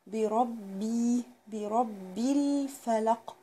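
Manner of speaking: بربي with a doubled باء, very slow; drawn-out برب الفلق; slow tajweed model; slow recitation. Shown as a demonstration. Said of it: "وشدد على الباء".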